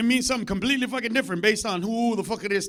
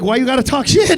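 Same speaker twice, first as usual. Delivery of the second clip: loud, high, offended protest; hurt protest; 4 semitones higher, 12 dB louder than usual. Retold or imitated. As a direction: complain loudly, stung. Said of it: "I was like".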